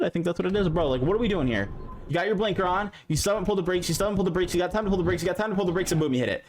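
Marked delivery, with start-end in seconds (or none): none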